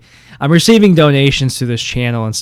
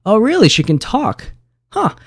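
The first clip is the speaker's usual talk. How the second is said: mock surprise; 8 semitones higher than usual. Host feigns surprise at robot.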